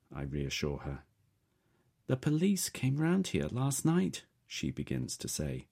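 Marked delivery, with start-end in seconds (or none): 2.1-4.2 higher, anxious mum voice; worried mother on the phone. A narrator's reading.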